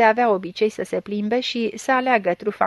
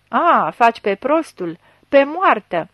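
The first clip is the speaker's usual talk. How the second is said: loud, exasperated interrogator; irritated accusation; 7 dB louder than usual. A narrator's reading. Chief accuses blind suspect of playing dumb, irritated. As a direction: push back hard at the feigned ignorance.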